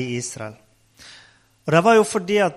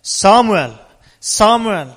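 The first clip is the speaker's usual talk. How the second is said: loud repeated call of a name; 3 semitones higher, 9 dB louder, slower than usual. Acted. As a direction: summon the boy twice, loud and measured. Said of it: "ropte på samme måte". (høyt, gjentatt rop)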